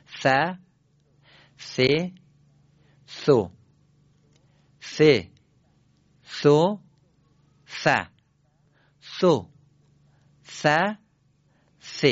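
ث said as a voiceless th, tongue between the teeth; repeat-after-me th syllables; th sound drills. Shown as a demonstration. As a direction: blow air over the tongue for ث. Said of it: "تک تک پشت سر من جواب بدید".

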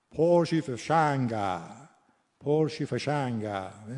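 slow Lur accent with open final vowels. Shown as a demonstration.

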